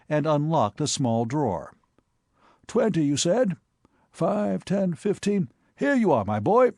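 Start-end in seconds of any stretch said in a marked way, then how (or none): 2.7-6.7 lightly voiced hearty old gentleman, a bit higher; teasing his nephew, amused and knowing; jovial uncle. A narrator's reading. Sir Thomas teasing his nephew over the letter, lightly jovial.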